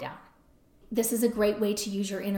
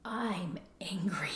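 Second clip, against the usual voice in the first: slow, hushed, almost breathless realisation; stunned whisper; 3 semitones lower, 8 dB quieter, much slower than usual. Retold or imitated. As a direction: whisper it as if the anger just dawned on you. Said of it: "I was like".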